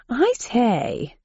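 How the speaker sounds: put-on plummy old English gent; lightly voiced posh gentleman. A narrator's reading. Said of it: "crumpets and tea at four o'clock sharp".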